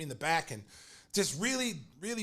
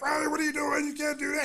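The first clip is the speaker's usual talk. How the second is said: high, loud mock-frantic scolding; hammed-up upset boss shouting; upset outcry; 11 semitones higher, 4 dB louder than usual. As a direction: squawk it in outraged alarm. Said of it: "he's all upset".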